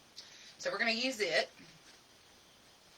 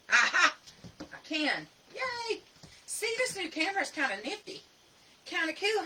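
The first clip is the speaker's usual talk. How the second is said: excited; 9 semitones higher than usual. Real, not acted. Camera zoom finally works.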